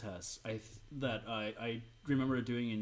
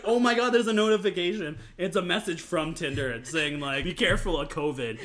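mock excitement; 4 semitones higher, 6 dB louder than usual. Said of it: "it's like".

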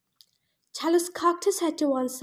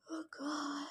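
real breathy whisper; whispered grief; 18 dB quieter than usual. Real, not acted. Podcaster grieving Bianca, whispering. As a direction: whisper it, crushed. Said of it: "such a bad one".

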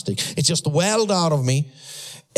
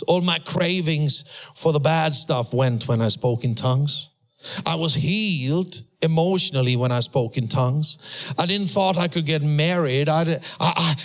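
normal talking voice

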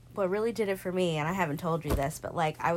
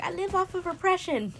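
high, louder jokey quip; high, loud joking confession; 12 semitones higher, 7 dB louder than usual. Retold or imitated. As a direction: toss it off as a joke, high. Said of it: "I make the joke".

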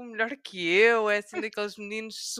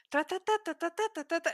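mimicking pupil rattling; 8 semitones higher than usual. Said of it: "E ele".

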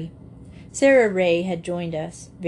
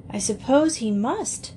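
higher, halting, mildly miserable; 6 semitones higher than usual. A narrator's reading. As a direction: admit it reluctantly, with pauses and a touch of misery. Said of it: "said Dan miserably".

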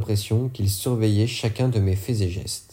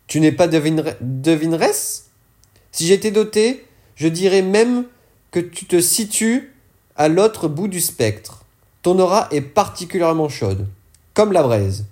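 sly fortune teller voice, pitched higher and a little louder; higher, teasing voice of the dealer; character voice, higher; 7 semitones higher, 4 dB louder than usual. Acted. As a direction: purr it as a knowing old dealer. Fourgueur speaking to Page.

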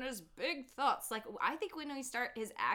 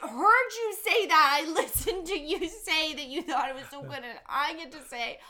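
high, loud insistent protest; raised-voice rebuttal; heated protest; 6 semitones higher, 8 dB louder than usual. Real, not acted. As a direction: argue back loudly, voice pitched up. Loud argument with co-host.

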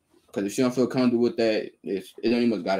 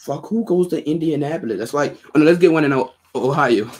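mocking disbelief; 7 semitones higher, 7 dB louder than usual. Real, not acted. Mocking friend's city choice.

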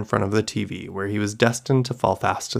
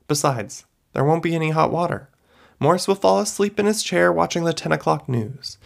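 firm, declaring voice, pitched higher; strong voice; 7 semitones higher than usual. Acted. Mrs Kuchek foretelling her own family's evening, in a strong voice. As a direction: state the future with conviction. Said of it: "spoke in a strong voice".

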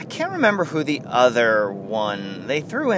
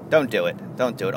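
ordinary talk

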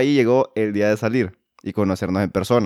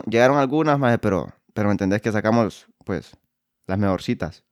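usual speaking voice

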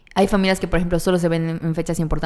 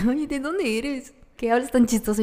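curious stranger; 6 semitones higher than usual. Retold or imitated. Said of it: "así de que".

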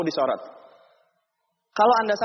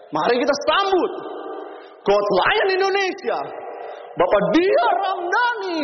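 shouted announcer call; 12 semitones higher, slower than usual. Retold or imitated. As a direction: belt it out like an award host. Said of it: "sambil teriak". (teriakan pembawa acara yang tinggi dan lambat)

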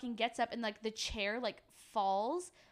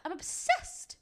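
gushing; 7 semitones higher, much slower than usual. Real, not acted. Gushing over the show.

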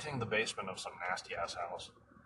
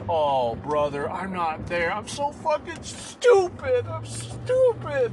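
high, slow, sobbing self-pity, voice breaking into tears; mock sobbing wail; 10 semitones higher, 10 dB louder, slower than usual. The no-show client wailing to the mover, hammed-up sobbing. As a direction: wail it like a grown man falling apart. Client wails at being called dumb.

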